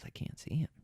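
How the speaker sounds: hushed, breath-only quote of a hunting buddy; imitated whisper. Cameraman behind the hunter, whispering that he can't see. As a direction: whisper it urgently to the hunter in front. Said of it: "taps me on the shoulder and says".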